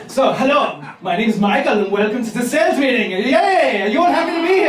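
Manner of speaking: high, upbeat German sales host; mock German sales-meeting welcome; German accent. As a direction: greet the team in a cheery German accent. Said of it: "you're working for a German company".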